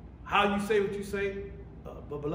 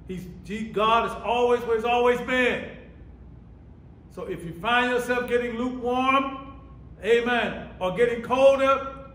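loud, raised sermon voice; emphatic preacher, pitched up and punching key words; full-force preaching; 5 semitones higher, 6 dB louder than usual. Real, not acted. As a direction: drive the point home to the congregation.

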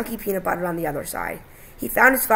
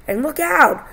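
high, loud cry of warning; warning shout; 8 semitones higher, 6 dB louder, slower than usual. A narrator's reading. Son warns Dad of falling knives, urgent shout.

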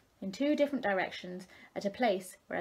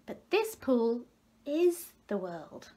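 slow insistence; 3 semitones higher, slower than usual. A narrator's reading. Tadpoles insist.

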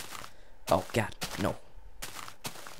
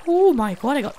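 excited cry; 8 dB louder than usual. Real, not acted. Thrilled at plenty of clay.

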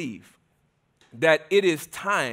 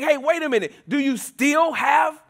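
exasperated Jesus; 7 semitones higher than usual. Acted. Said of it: "and he's saying".

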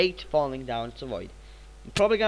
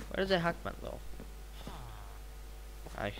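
usual speaking voice

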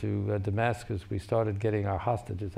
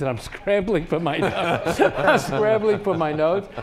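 higher and louder, slow, through real laughter; cracking up mid-sentence; laughing while talking; 9 semitones higher, 9 dB louder, slower than usual. Real, not acted. Ambassador laughing about scrambling for his notes.

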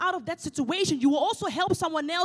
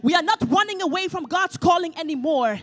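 louder, building declaration; rising preaching; 2 semitones higher, 8 dB louder than usual. Acted. Preacher declaring a new day to the church, full force. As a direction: build it up to a proclamation.

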